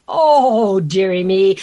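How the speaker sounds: loud, hammed-up lament from a storybook king; big swooping self-pitying moan; mock sobbing. A narrator's reading. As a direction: wail it theatrically, falling on the last words. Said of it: "The King sobbed".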